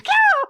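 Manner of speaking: very high, loud cartoon squeal; sudden shrieked "kya" as if falling; Urza. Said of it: "she kinda screams".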